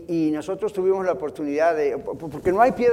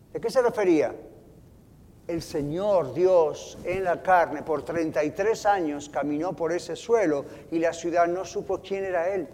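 ordinary talk, nothing marked

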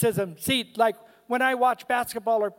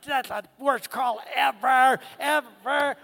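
armchair fan's rant at the TV, drawn out toward the end; mock yell at refs; 2 semitones higher than usual. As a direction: start with a raised outburst, then stretch out the complaint. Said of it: "I yell at the refs".

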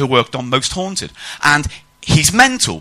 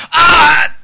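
high, loud, drawn-out cry of alarm; hammed-up terror at full volume; mock panicked shout; 13 semitones higher, 5 dB louder, much slower than usual. Ghost hunter crossing haunted threshold, mock panicked shout.

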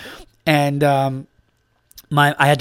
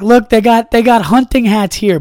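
gleeful discovery, higher and louder; excited pointing out; 8 semitones higher, 6 dB louder than usual. Retold or imitated. Comic pointing out the silly hat to a friend, excited. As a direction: look at this, thrilled. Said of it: "and I'm like".

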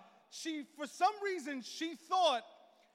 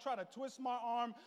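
ordinary talk

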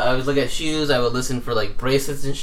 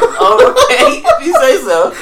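much higher, louder sarcastic; mocking laugh; 10 dB louder, slower than usual. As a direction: humor him, laughing. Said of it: "I'm like".

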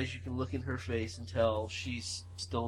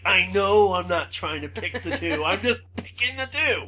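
loud, higher protest; defensive retort; 7 semitones higher, 9 dB louder than usual. Retold or imitated. Answering mom beside her at the machine, loud and defensive. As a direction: insist you're not doing it on purpose.

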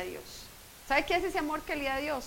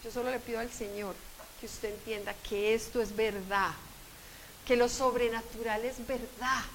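ordinary delivery

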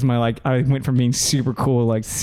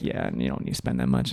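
whispered aside; 12 semitones lower, 7 dB quieter than usual. Retold or imitated. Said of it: "she's like".

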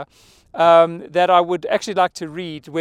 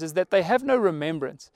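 ordinary delivery

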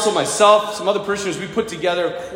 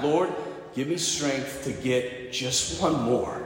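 low, soft, slowing plea; retold prayer; 6 semitones lower, 9 dB quieter, slower than usual. Exhausted medic prays to rescue another man, hushed slow plea. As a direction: pray it quietly and slowly, spending the last strength. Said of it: "he prays this prayer every time".

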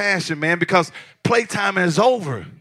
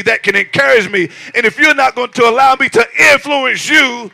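loud, high, driving pulpit cry; whooping sermon build; full-force preaching; 8 semitones higher, 10 dB louder than usual. Acted. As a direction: preach it hard to the congregation.

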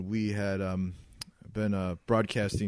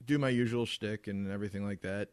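normal talking voice

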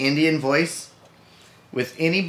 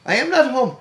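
mock Rooney voice; 10 semitones higher, 4 dB louder than usual. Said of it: "impersonating Rooney".

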